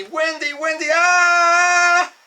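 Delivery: high-pitched yell stretched into a long "ah"; retold panicked yelling. Friends yelling a warning to the pilot, high drawn-out shout. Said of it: "everybody's yelling out to me".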